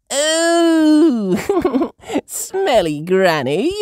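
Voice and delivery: high, loud giggly tease; Peppa giggling. A narrator's reading. Peppa teasing smelly Granny with a laugh. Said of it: "says Peppa".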